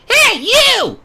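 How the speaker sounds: loud, high call from far off. A narrator's reading. A stranger calls out to Yuya, loud and high. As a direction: shout it across the empty yard. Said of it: "someone called out".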